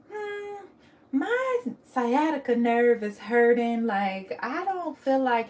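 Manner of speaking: mock whiny mom, slow and drawn out; a hammed-up complaint from a mom who won't come; reluctant mom. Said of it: "My mom was like".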